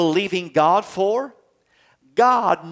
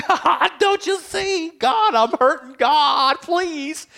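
much higher, slow whimpering plea; a tantrum-throwing child crying out to God; whiny squalling child; 12 semitones higher, slower than usual. Acted. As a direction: whine like a bawling kid begging God. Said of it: "God's squally bally kids".

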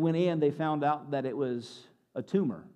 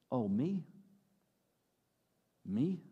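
small, soft, unsure question; shrinking self-doubt; 4 semitones lower, 7 dB quieter, slower than usual. Acted. Believer realising the promise is personal, acted hushed disbelief. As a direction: shrink back, hardly daring to ask. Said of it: "when I start to really let the rubber meet the road".

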